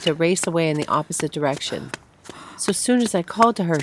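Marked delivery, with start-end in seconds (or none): 2.6-3.8 breathless, running, higher; gasping while running. Acted. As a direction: gasp it out on the run. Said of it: "she gasped, running".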